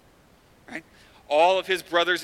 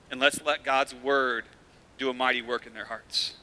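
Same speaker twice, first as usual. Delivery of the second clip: usual speaking voice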